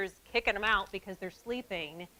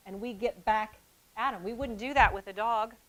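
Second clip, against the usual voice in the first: ordinary talk, nothing marked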